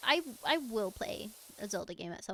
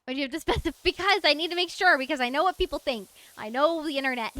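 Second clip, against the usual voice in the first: loud, high and defensive; 5 semitones higher, 10 dB louder, faster than usual. Real, not acted. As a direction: protest loudly, half laughing. Defends not being a furry.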